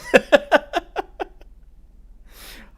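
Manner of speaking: sudden squealing laugh, high and loud; high burst of laughter. Real, not acted. Laughing at own joke.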